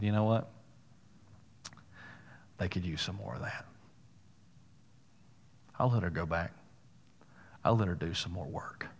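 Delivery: soft, low imagined voice of God; God musing quietly. Retold or imitated. God's quiet words, imagined by preacher.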